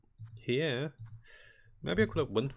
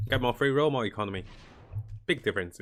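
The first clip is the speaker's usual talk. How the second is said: usual speaking voice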